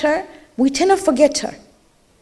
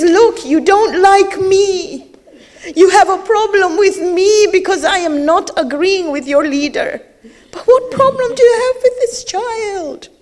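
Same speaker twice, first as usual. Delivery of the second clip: tearful plea; 6 semitones higher, 3 dB louder than usual. Acted. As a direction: plead for the child through tears. Imam pleading before the army.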